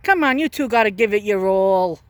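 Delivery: ordinary talk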